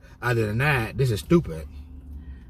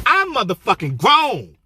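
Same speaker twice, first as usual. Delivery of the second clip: furious shout; 13 semitones higher, 6 dB louder than usual. Retold or imitated. Aide yelling at the administrator who asked what her issue was, a loud high furious shout. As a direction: blow up at the administrator. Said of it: "I went off".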